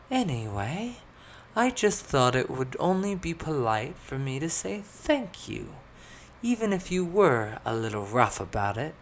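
high voice of grudging politeness; pompous noblewoman. A narrator's reading. Elizabeth thanking companions, pointed at the last part, high haughty voice.

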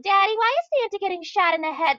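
put-on high, innocent child asking; little kid voice. Little Timmy asking his dad about the movie, childlike high voice. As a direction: ask it like a wide-eyed small boy. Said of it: "little Timmy coming up to you and saying".

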